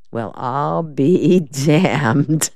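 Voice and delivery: slow, laughing softly through it; soft amused laugh. A narrator's reading. Mason hears Della blushed calling Paul, laughing softly. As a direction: chuckle it out, half to himself. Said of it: "Perry Mason said softly".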